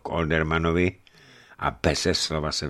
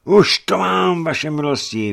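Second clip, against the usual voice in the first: delighted merchant's outburst; joyful cry; 12 semitones higher, 7 dB louder than usual. A narrator's reading. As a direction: cry out with glad relief. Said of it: "vykřikl radostně Fuss".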